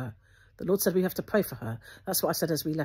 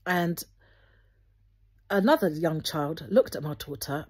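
ordinary delivery

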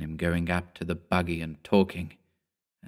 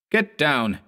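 yelling; 6 semitones higher than usual. A narrator's reading. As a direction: bark it over the pistol. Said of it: "he yelled".

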